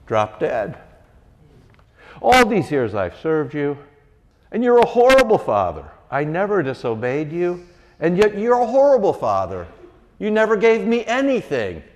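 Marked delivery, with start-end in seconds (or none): 2.2-11.8 high, loud bitter whine; sulking older brother. Retold or imitated. Older brother yells resentment at his father outside the feast. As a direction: hurl the complaint at father. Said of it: "he says".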